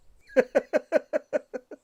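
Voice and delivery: long, high-pitched run of giggles; amused laugh. Acted. Host teases guest about tea faces, giggling. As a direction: laugh in a string of quick high bursts.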